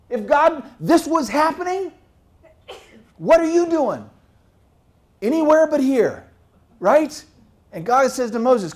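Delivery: loud, rising sermon voice; fiery preacher building up; preaching at full force. Acted. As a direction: preach it out to the whole church.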